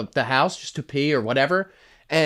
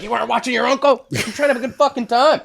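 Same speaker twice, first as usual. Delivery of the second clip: higher, louder, fast mock scolding; mock blame; 10 semitones higher, 5 dB louder than usual. Family blames the boy for his uncle's tight belt, mock scolding. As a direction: tear into the kid for slacking, faster and higher.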